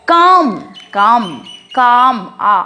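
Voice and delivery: কাম with an open আ vowel, stretched and stressed; English word pronunciation. Shown as a demonstration. Teacher showing students the vowel in come, pronounced carefully. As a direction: say কাম slowly, leaning on the আ sound. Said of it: "আ এর মতো উচ্চারণ করছি".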